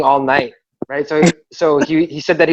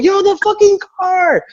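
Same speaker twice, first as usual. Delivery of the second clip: imitated scream. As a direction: mimic a friend shrieking about the car. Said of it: "Janice is screaming".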